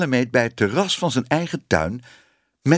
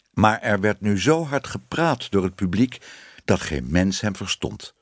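ordinary delivery, nothing marked

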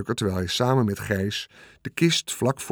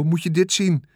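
calling out; 6 semitones higher than usual. A narrator's reading. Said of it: "riep Michiel".

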